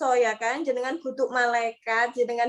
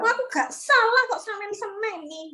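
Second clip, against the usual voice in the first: higher, hurt voice insisting on innocence; wronged spouse protesting, higher and aggrieved; indignant protest; 8 semitones higher than usual. Retold or imitated. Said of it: "gak salah apa-apa diseneni bojo".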